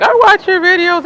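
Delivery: high, put-on voice of an eager fan. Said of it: "he's like".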